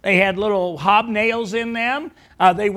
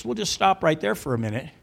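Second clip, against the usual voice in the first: usual speaking voice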